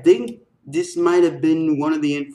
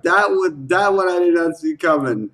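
ordinary delivery

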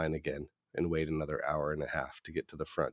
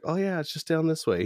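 imitated lady, much higher pitch; lady's voice; 12 semitones higher, 3 dB louder than usual. Lady gives a limo driver directions, imitated high voice. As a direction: lift it high as a helpful lady. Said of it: "So then the lady's like".

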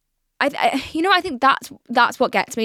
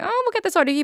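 higher-pitched imitation of reassuring staff; imagined reassurance; 11 semitones higher, faster than usual. Health staff promising a young patient quick treatment, mock reassurance. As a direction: lightly voice someone promising quick help.

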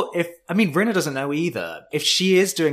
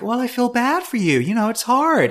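higher, kind sympathetic tone; 10 semitones higher than usual. Retold or imitated. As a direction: sound gently sympathetic. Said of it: "like a normal person".

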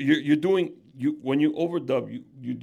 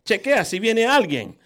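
much higher, louder dubbed tough-guy lines in Spanish; squeaky Spanish dub; 7 semitones higher, 11 dB louder than usual. Shown as a demonstration. Host demonstrating the squeaky dub voice to his guest. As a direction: overact it like a Spanish film dub. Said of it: "make it a squeaky voice".

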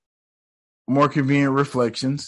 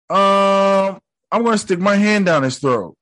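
mock squeamish Steven; 8 semitones higher, 4 dB louder than usual. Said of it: "He said".

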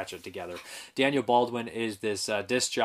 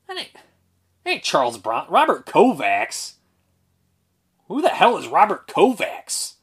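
surprised; 6 semitones higher, 7 dB louder than usual. Real, not acted. Surprised at the name.

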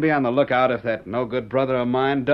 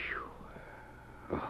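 whisper; 20 dB quieter than usual. Acted. Farewell said under breath.

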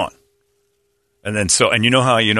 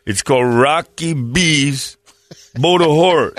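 Rocky impression; 2 semitones higher, slower than usual. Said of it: "Yo, Adrian".

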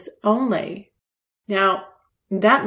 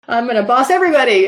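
bossy; 3 semitones higher, 6 dB louder, faster than usual. Pretend. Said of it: "I am into bossing people".

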